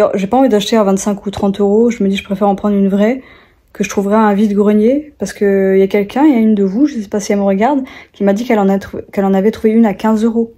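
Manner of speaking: ordinary delivery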